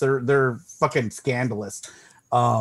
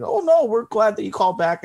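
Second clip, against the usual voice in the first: higher-pitched courteous phone-agent voice; polite creditor; 7 semitones higher than usual. Retold or imitated. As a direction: lift the pitch into mock customer-service politeness. Creditor's polite reply acted out.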